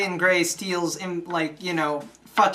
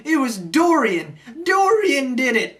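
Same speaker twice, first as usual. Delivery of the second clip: higher, hammed-up last words; dying accusation; 5 semitones higher, 3 dB louder than usual. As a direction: gasp out the culprit's name, mock-dying. Gutted first mate names his killer.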